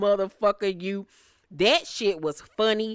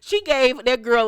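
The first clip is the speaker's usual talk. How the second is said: normal talking voice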